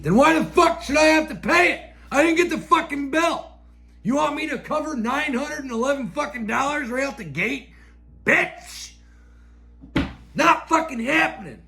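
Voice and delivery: high, heated mock rant at the clerk; retold angry outburst. Retelling his fight with the phone clerk.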